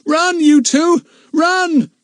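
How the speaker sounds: high, loud yell. A narrator's reading. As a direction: shout for them to flee. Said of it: "yelled Philip at the top of his voice".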